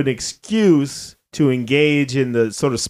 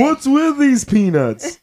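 higher, slow mock stand-up whine; hammed-up Seinfeld peanut gripe; Jerry Seinfeld impression; 9 semitones higher, slower than usual. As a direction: drag it out like an observational bit. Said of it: "that's Jerry Seinfeld".

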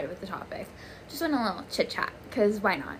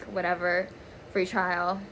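normal talking voice

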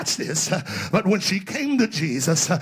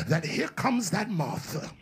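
usual speaking voice

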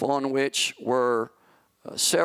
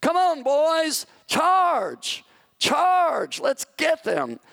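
much higher, louder, slow rallying shout; battle shout; 13 semitones higher, 4 dB louder, much slower than usual. Retold or imitated. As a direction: roar the charge at the top of your lungs. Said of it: "shouted at the top of his lungs".

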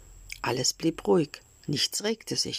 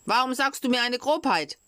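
angry accusation; 9 semitones higher than usual. Acted. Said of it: "fuhr den Schreiner wütend an".

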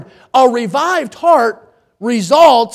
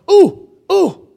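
put-on high, excited pleading like a child wanting picking; eager volunteer; 8 semitones higher, 4 dB louder than usual. Isaiah with hand raised to be picked, eager high pleading. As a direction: squeal repeated oohs, begging to be chosen. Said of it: "Isaiah with his hand raised in the air going".

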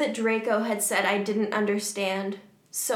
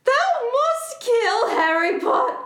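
high, loud, slow villain voice, cracking; hammy dark-lord command that cracks; Voldemort voice; 14 semitones higher, 7 dB louder, slower than usual. Retold or imitated. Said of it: "how to do a Voldemort voice".

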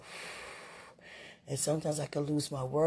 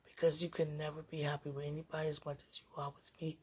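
ordinary talk

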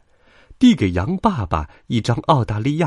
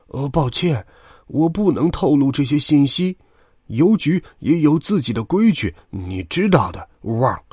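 old dog's put-on voice, raised in pitch; hushed-throated old dog; 7 semitones higher than usual. A narrator's reading. Old grey dog refuses sheep mother information, guarded dog voice. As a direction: answer officially as an old dog, ending in a bark. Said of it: "老灰狗压低嗓子回答".

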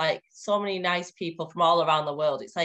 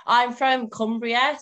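Cumbrian accent; 4 semitones higher, 5 dB louder, slower than usual. Real, not acted. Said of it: "I could tell by the accent".

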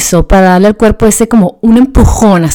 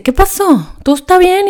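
husband's worried question; 10 semitones higher than usual. Retold or imitated. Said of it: "y me dice".

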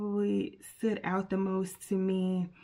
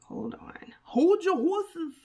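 drawn-out playful "hold your horses", higher and louder; playful stall; 9 semitones higher, 4 dB louder than usual. Pretend. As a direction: tease the audience to wait, stretching it. Stalling while searching her phone.

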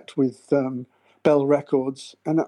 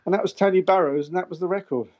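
usual speaking voice

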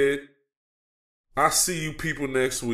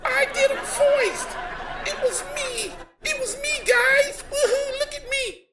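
mocking Cody Rhodes. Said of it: "He's just out there basically saying".

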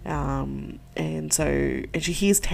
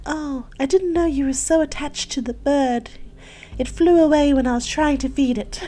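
high, hammed-up false innocence; put-on voice; 9 semitones higher, 4 dB louder than usual. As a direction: lie sweetly in a higher put-on voice. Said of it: "I'm putting a voice on now".

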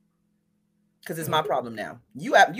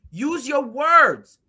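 even slower, louder; slow, loud, higher scolding; 6 semitones higher, 6 dB louder, much slower than usual. Retold or imitated. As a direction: drag each word out. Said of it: "I'm in the middle like".

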